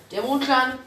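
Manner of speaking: loud, urgent Mondclan; the cat blurts it out. Acted.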